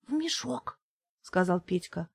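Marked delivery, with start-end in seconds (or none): 0.1-0.8 small hushed plea. Acted. Kid pleads at night.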